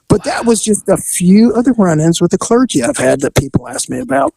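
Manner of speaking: ordinary delivery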